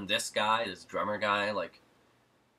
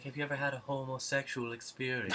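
raised, teasing flamboyant voice with a laugh; 6 semitones higher than usual. Retold or imitated. Arosio quoted, listener laughs.

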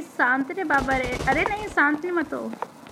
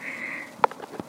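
soft laugh; 5 dB quieter than usual. Real, not acted. After saying love you.

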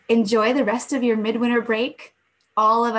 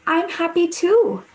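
bright joyful reply, higher and louder; happy; 9 semitones higher, 7 dB louder than usual. Acted. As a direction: burst out glad. Squirrel answers bird warmly.

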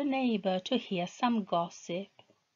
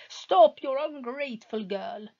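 husband chasing his wife; angry shout; 6 semitones higher than usual. Acted. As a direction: bark at her to stop. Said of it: "he shouted angrily".

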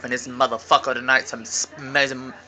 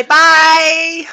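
loud, high-pitched squealed farewell; excited sign-off; 13 semitones higher, 9 dB louder, much slower than usual. Real, not acted. Signing off to vlog viewers, excited high goodbye. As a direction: call it out high and big.

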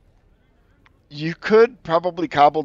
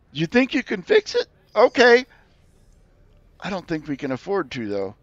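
high doubtful creature; 6 semitones higher than usual. Acted. [name]'s creature voice.

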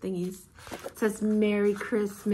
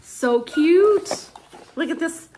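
gushing; 7 semitones higher, 5 dB louder, slower than usual. Real, not acted. Gushing over the gift boxes.

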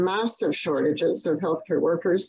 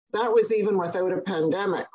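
normal talking voice

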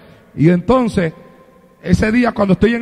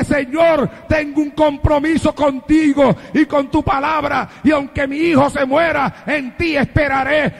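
crying out to God; 4 semitones higher than usual. Retold or imitated.

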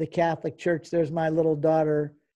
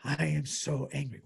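softer, understated replay of anger; subdued retold anger; 3 semitones lower, 5 dB quieter than usual. Husband recapping his wife's outburst, restrained mock anger. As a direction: repeat the angry quote with the force held back. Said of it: "that is shocking conduct from her".

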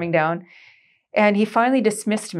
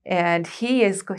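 usual speaking voice

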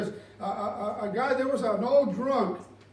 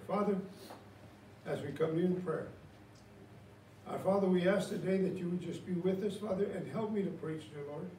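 soft, low pastoral prayer voice; 5 semitones lower, 8 dB quieter than usual. Acted. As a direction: pray over the congregation gently. Leads congregation in prayer.

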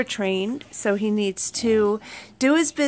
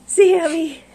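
startled call; 6 semitones higher, 8 dB louder than usual. Real, not acted. Pig slipped on step.

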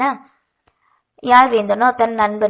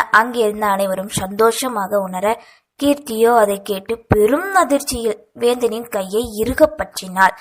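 ordinary delivery, nothing marked

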